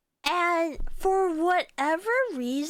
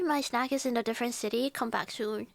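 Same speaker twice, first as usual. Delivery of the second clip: usual speaking voice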